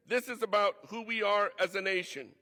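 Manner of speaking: normal talking voice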